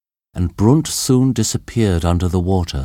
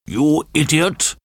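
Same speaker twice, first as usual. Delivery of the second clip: higher furious yell; furious shout; 9 semitones higher than usual. A narrator's reading. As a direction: snap it in fury. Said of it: "von Lansen shouted furiously to Thomas".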